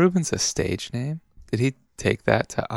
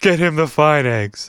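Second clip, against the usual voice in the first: louder mock New Jersey order; New Jersey accent; 4 semitones higher, 5 dB louder than usual. Diner regular calling for eggs, New Jersey accent. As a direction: bark it like a Jersey diner guy. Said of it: "I'm from New Jersey".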